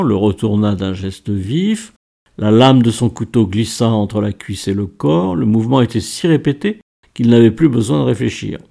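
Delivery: plain reading voice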